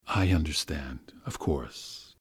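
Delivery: ingratiating whisper. A narrator's reading. Master whispering to the governess to soothe her, ingratiating. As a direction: whisper to win her over. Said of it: "went on in an ingratiating voice".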